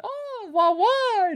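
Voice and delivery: very high squealing fake French accent. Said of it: "her fake French accent".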